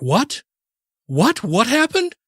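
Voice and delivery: sudden fear as bad news lands; shocked disbelief.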